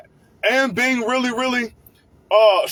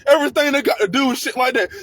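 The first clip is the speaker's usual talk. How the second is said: ordinary talk, nothing marked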